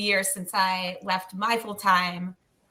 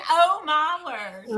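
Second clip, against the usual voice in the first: Oh, my word. drawn out slowly and higher, imitating; echoed mock quote; 8 semitones higher, 3 dB louder, slower than usual. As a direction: replay the words as someone else would say them, slow and higher. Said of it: "I can hear".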